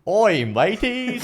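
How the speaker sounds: high, slow laughing Aussie "Oi, mateys"; Aussie Steve Irwin accent. Pretend. Friend asks for Irwin impression, giggling high Aussie accent. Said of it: "do your best Aussie accent of Steve Irwin".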